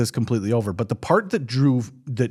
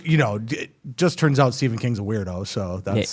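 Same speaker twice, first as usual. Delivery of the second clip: usual speaking voice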